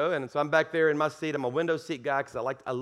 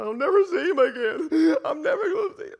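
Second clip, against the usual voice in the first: much higher, crying voice with a hint of a sob; imitated crying; 11 semitones higher, 4 dB louder than usual. Pastor imitating the grieving businessman crying on the plane. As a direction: mimic a grieving man's wail. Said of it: "And, like, crying".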